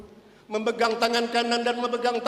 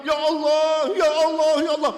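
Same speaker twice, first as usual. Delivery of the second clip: anguished cry; 5 semitones higher, 4 dB louder than usual. Acted. Bilal crying out in fear.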